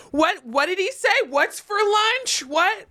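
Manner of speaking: put-on high, puzzled kid voices; confused kids. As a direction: squeak it out like confused fifth graders. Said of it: "my fifth graders were like".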